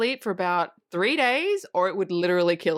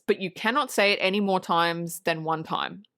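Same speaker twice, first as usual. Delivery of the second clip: ordinary talk, nothing marked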